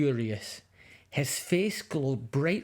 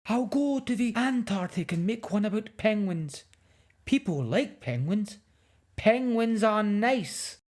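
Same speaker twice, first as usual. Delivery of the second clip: lightly voiced huffy filmmaker; 7 semitones higher than usual. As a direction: pout about penguins. Filmmaker decides to leave.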